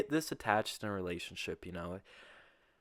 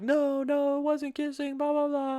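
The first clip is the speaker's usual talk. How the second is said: very high falsetto imitation; exaggerated high-pitched girlfriend voice; mock girlfriend denial; 5 dB louder than usual. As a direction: squeal a mock protest in falsetto.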